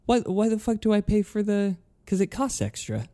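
high-pitched exasperated complaint; annoyed. Retold or imitated. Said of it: "a little annoyed".

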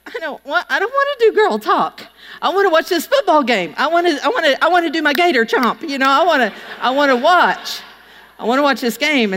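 put-on high, whiny pleading like a sulky kid; whiny protest. To herself at the party, a high whiny protest. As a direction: whine that you just want the game.

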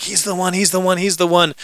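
high, giddy mock gushing; gushing infatuation. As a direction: gush it like someone newly smitten. Imagined infatuated girlfriend gushing.

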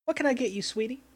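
sweet, high waitress asking an order; diner waitress. Acted. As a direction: coo it like a friendly waitress. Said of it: "she puts her hand on Percy's shoulder".

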